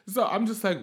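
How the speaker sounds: ordinary delivery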